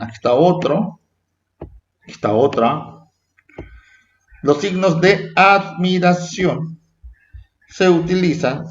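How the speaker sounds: ordinary talk, nothing marked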